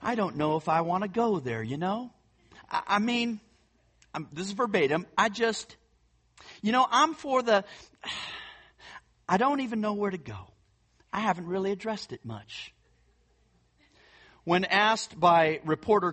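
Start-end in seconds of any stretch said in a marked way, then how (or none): 0.0-3.4 light imitation of a Texas accent; Texan accent. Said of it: "it's hard not to do this without the accent". 5.2-12.7 light imitation of a Texas accent; mock-Texan, higher and hesitant. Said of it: "it's hard not to do this without the accent".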